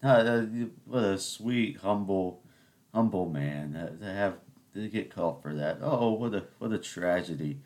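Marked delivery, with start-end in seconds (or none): none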